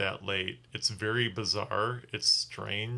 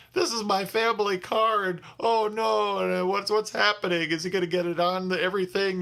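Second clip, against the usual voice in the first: much higher, louder retold panic; freaking out; 9 semitones higher, 3 dB louder than usual. Driver freaking out about his family car, high mock panic. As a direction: squeal it like your car is doomed. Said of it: "I'm freaking out".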